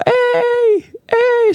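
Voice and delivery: very high, drawn-out "ei" calls; imitated crowd cheer. The crowd to the performer on stage, imitated cheer. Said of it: "Sit jengi on vaan".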